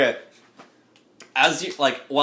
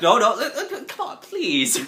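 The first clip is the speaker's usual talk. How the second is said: high-pitched comic begging; pleading; 9 semitones higher than usual. Acted. Game master voices the arguer begging for dinner, high pleading. Acted character begs after the threat.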